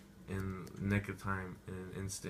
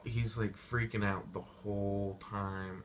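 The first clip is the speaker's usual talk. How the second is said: usual speaking voice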